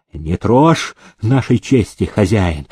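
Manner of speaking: plain reading voice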